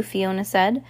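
usual speaking voice

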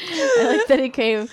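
theatrical, high and loud; overdone stage voice; hammy stage actor. Acted. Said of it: "impression of a theater person".

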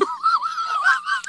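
shrieking high laugh. Real, not acted. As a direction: explode into laughter. Bursts out laughing at story.

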